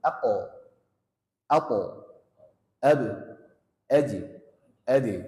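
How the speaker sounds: ako, apo, abu, aji, adi with bouncing stops; qalqalah bounce. Shown as a demonstration. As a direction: pop each stop cleanly. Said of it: "kalau kita belajar aslinya itu".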